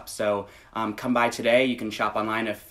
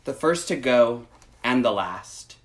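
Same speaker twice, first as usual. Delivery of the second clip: gentle, measured picture-book read; reading to children; 2 semitones higher, slower than usual. Acted. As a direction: read it slowly to little listeners. Reads picture book aloud.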